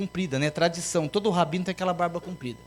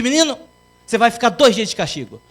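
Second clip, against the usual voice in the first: stern punishment; 6 semitones higher, 6 dB louder, faster than usual. Acted. Teacher punishes pupil.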